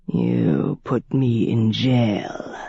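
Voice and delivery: a predator savoring every word; menacing old vampire. A narrator's reading.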